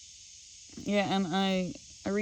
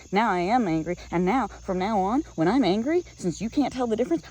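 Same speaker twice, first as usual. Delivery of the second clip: rising, louder heated retort to father; building anger, pitch climbing higher and louder; retold yelling at dad; 6 semitones higher, 3 dB louder than usual. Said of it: "I yelled at him".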